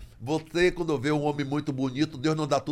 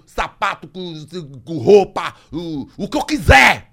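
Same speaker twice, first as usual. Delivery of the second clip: slow, much louder demand; demanding shout; 3 semitones higher, 11 dB louder, slower than usual. Retold or imitated. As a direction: bark the list of goods. Oficial exigindo tudo, alto. (oficial exigindo tudo, gritando devagar)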